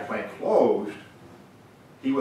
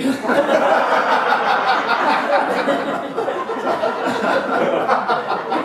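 loud high laugh; 10 dB louder than usual. Real, not acted. Teller laughing at his story.

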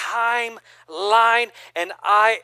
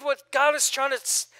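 normal talking voice

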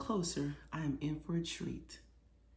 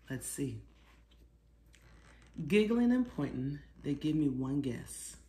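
ordinary delivery, nothing marked

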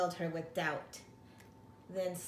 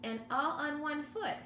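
crowing; 7 semitones higher than usual. A narrator's reading. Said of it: "Dad crowed to his chick".

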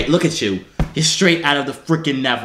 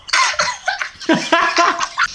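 high-pitched cackle; laughing. Real, not acted. Prankster cracks up after his catchphrase, high laughter.

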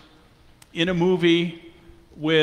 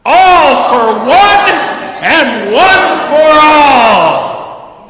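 slow, high, full-voice group shout; belted shout; 10 semitones higher, 16 dB louder, much slower than usual. Pretend. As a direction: belt it out like a ship's crew. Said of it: "So let's belt this thing".

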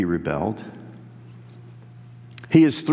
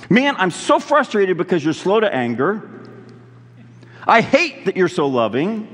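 higher and louder, building to a frustrated cry; angry Jonah; 8 semitones higher, 3 dB louder than usual. Retold or imitated. Preacher acting out Jonah raging at God's mercy, building frustrated shout. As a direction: hurl God's kindness back as a weapon. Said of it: "He angrily uses them, like, almost as weapons".

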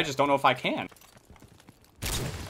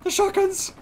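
shrill shout; slower than usual. Real, not acted. Repeats it even higher.

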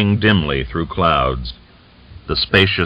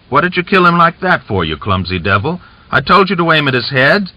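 loud, higher angry rebuke; angry scolding; 9 semitones higher, 4 dB louder than usual. Acted. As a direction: tear into him for botching it. Cossack scolds a clumsy comrade.

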